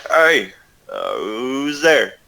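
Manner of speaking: rough sailor calling through the door; a gruff dockside tough asking who knocks. Acted. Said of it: "you hear a gruff voice from the inside".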